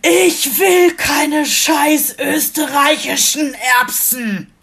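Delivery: high, drawn-out mock yell; imitating a furious kid's loud tantrum; imitated child's scream. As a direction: screech it like a small child having a meltdown. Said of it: "er schrie so laut".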